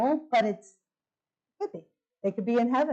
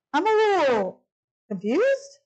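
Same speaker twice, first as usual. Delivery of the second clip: high, louder feigned puzzlement; pointedly confused; 7 semitones higher, 4 dB louder, slower than usual. Retold or imitated. Patient's wife challenging the desk lady, pointedly puzzled. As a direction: draw out the puzzlement, pitched up and pointed.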